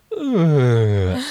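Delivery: imitated knocked-out groan; short pained ugh as he drops; guard grunt. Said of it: "they would make this very distinctive".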